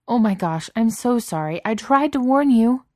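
high, loud, rising distress; panicked apology. A narrator's reading. Beast to Cook hurt by thorns, panicked apology.

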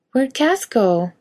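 put-on little kid just woken, slow; small child. A narrator's reading. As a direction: ask like a toddler just woken.

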